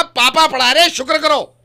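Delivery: high, loud angry telling-off; scolding son loudly. Retold or imitated. Father scolding his son about tables, loud angry rebuke. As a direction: scold the boy for not valuing his dad. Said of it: "मैंने कहा".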